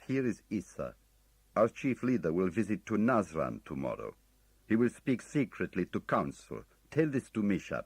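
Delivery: urgent foreign man on an answering machine; guttural foreign voice. A narrator's reading. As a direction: press the message through fast and urgent. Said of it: "A guttural foreign voice, male, thick and urgent".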